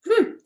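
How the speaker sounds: high, loud disdainful humph; indignant witch huff; huffy humph. Acted. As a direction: scoff at the mummy. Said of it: "said the witch".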